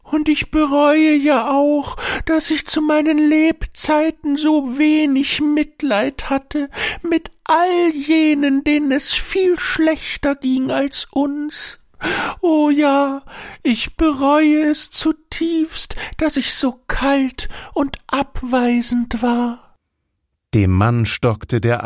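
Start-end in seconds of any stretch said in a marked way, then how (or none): 0.0-16.0 high, rueful tree spirit voice; a sorrowful old tree confessing; remorseful tree. A narrator's reading. 16.2-19.7 remorseful whisper. A narrator's reading. Tree confesses, voice sinks.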